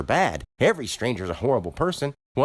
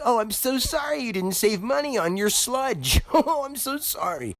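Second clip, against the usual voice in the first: much higher, sing-song fake apology with a laugh; mock sympathy. Mocking the customers over their soda, sarcastic fake-sorry. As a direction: drip with sarcastic pity. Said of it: "Act like".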